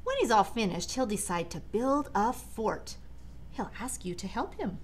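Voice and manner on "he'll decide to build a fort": ordinary delivery, nothing marked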